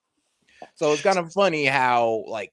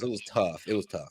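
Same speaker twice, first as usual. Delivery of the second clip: quiet; 8 semitones lower, 9 dB quieter than usual. Real, not acted. Sighing about the loss.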